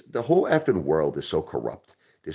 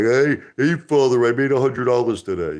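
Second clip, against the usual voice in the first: high, loud, mock-eager little investor; mocking small investor; 6 semitones higher, 5 dB louder than usual. Mocking retail investors bragging to their ape father, high and eager. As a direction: play a proud small-timer calling out to dad.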